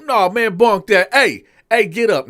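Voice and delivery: loud, high mock order; retold captain yelling at the cell; barking captain. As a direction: rouse him from his bunk. Captain wakes the inmate.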